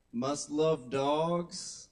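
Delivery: drawn-out, slow quoting of a profile line; slow quoted phrase. Shown as a demonstration. Comic reading a dating profile cliché to the crowd, slowly drawn out. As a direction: stretch it like a cliché you've read too often.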